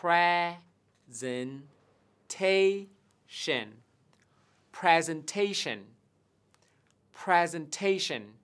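"Presentation" slow with pre kept level; even pre stress. Shown as a demonstration. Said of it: "đọc nó ngang một chút nhé". (đọc "Presentation" chậm, âm pre ngang)

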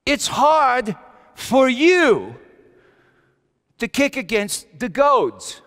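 booming voice calling Saul, then slowing down; a commanding divine call that slows at the end; voice from heaven. Acted. The voice of Jesus calls out to Saul, booming. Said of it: "And a voice said this".

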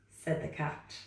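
plain reading voice

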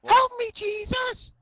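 high, loud plea; sudden high exclamation; loud cry. Real, not acted. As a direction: cry out to the heavens. Startled cry during the match.

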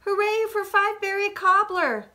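high, delighted hooray; joyful cheer. A narrator's reading. Story character cheering good news.

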